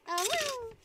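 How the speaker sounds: ordinary talk, nothing marked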